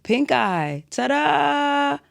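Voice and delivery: high, drawn-out singsong announcement; mock ta-da reveal. Podcaster revealing an ailment to listeners, mock fanfare. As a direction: present it like a playful grand reveal.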